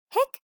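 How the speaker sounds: high, loud squeaky hiccups; lamb hiccups. Acted. Lamb with hiccups, loud high hiccups.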